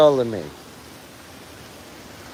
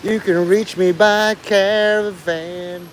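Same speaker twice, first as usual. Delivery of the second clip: slow, higher put-on girl's voice; imitating the girl; 6 semitones higher, much slower than usual. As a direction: drag it out like a spacey quote. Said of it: "it's like, you know".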